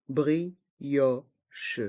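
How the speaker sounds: slow "Brioche" in separate beats; learner-paced, split "Brioche"; syllable by syllable. Shown as a demonstration. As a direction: pull "Brioche" apart, bri, o, che. Teacher breaks word into syllables.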